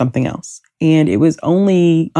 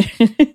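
short embarrassed laugh; a quick self-conscious giggle; 8 semitones higher than usual. Real, not acted. Laughs about hating own voice.

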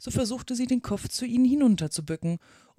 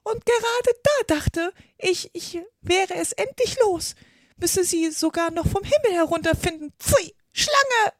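raised voice; 11 semitones higher, 4 dB louder than usual. A narrator's reading. Pigeon outraged that snakes found it, voice raised. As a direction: build to a disgusted screech at the end. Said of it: "mit erhobener Stimme fort".

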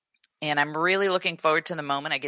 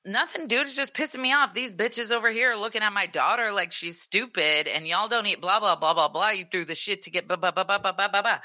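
retold rant, pitched higher and faster at the end; going off; 6 semitones higher than usual. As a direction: replay the blow-up, voice up high and heated. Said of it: "I went off, and then I couldn't stop".

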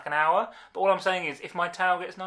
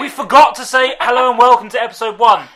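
laughing; 6 semitones higher, 13 dB louder than usual. Real, not acted. Laughing at forgetting the intro.

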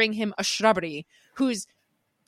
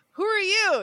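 higher mock question; knights asking; 11 semitones higher, 3 dB louder than usual. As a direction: ask it in a high put-on voice. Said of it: "And they're like".

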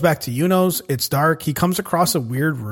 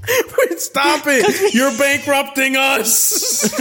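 high, loud, slow laughing protest; laughing plea; 10 semitones higher, 5 dB louder, much slower than usual. Pretend. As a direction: beg them to stop through laughter.

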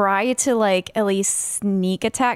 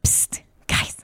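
louder stage whisper. Pretend. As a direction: whisper so the mic picks it up. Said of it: "Let's whisper a little louder 'cause it's really hard to pick up".

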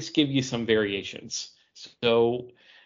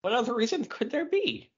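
mock innocent; 12 semitones higher, 4 dB quieter than usual. Teasing innuendo question.

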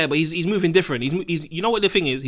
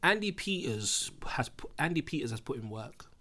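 hushed; 4 semitones lower, 11 dB quieter, slower than usual. Real, not acted. Solemn tribute.

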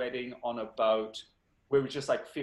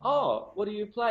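polite interest; 8 semitones higher, 5 dB louder than usual. Retold or imitated. Acting own polite interest in story.

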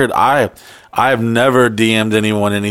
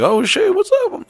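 surprised. Retold or imitated. Retold surprised reply to a message.

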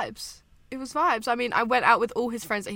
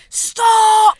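high loud shout of stop; 10 semitones higher, 12 dB louder than usual. Retold or imitated. Family telling her to stop the woo, shouting. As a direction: yell it at her to quit. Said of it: "everyone was just going".

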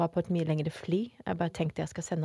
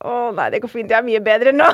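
loud retold reassurance breaking into laughter; laughing backtrack; 5 semitones higher, 13 dB louder than usual. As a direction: insist brightly, louder and higher, then crack up.

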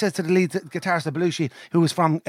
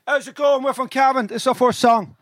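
louder, higher stage introduction; band frontman greeting; 6 semitones higher, 4 dB louder than usual. Retold or imitated. Band opening their set to the bar, stage announcer voice.

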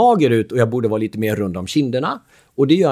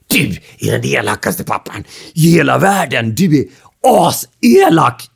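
louder, slower mock tantrum; hammed-up furious kid yelling at dad; angry child; 2 semitones higher, 7 dB louder than usual. Son furious at dad over ice cream, mock shout. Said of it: "Han blir förbannad ... skriker han".

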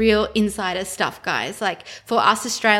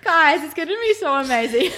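bright laughing gush of excitement; bubbling over with joy; excited; 7 semitones higher than usual. Real, not acted. Gushing about the Italy trip.

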